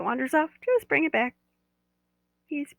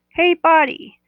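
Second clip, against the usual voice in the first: bright louder call to the body; 7 semitones higher, 10 dB louder, slower than usual. Shown as a demonstration. Guide showing how to ask the body, calling out. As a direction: call out to your own body. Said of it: "I'm gonna ask you to ask your body".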